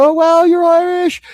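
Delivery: imitating mom. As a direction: gush like an excited mother. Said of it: "She's like".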